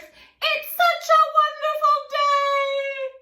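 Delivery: high, loud, slow cheerful sing-song; cheery friends. Acted. Monkey friends cheer grumpy Jim, bright and loud. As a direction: gush about the lovely day.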